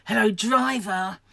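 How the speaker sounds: slow, drawn-out hello driver; mock obnoxious passenger calling the driver; obnoxious bus passenger. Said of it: "We kind of go".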